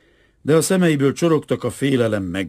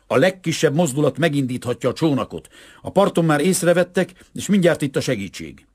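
raised, higher urgent call; shouted warning; 6 semitones higher, 3 dB louder than usual. A narrator's reading. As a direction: call a warning over the roar. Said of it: "kiáltotta a pokoli dübörgésen keresztül".

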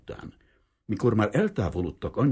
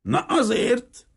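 very high, slow, loud crackling retort; loud creaky old-timer's triumphant reply; croaking old man; 6 dB louder, much slower than usual. Acted. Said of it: "recsegte vissza az öreg hiribi".

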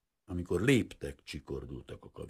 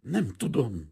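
higher, hesitant servant voice; frightened stammer; 7 semitones higher, 4 dB louder, slower than usual. A narrator's reading. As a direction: falter it out, startled. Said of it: "dadogta a leány".

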